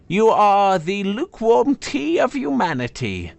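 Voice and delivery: "tea" dropped in a high, scornful fall; sneering insult. Acted. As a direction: land "tea" with contempt, then let it fall. Sword's insult, first take.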